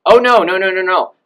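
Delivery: high, loud mock protest; mimicked teachers' loud objection; objecting other teachers. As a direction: rattle out the "no"s as a scandalized objection. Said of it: "Other teachers will say".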